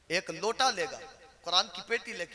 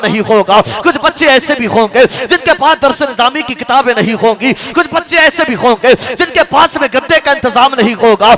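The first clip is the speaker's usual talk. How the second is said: impassioned preaching; 6 semitones higher, 20 dB louder than usual. Acted. Preacher urging congregation to help madrasa students, fervent and loud. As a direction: build the sermon to a ringing, high-pitched peak. Preaches at full force, building.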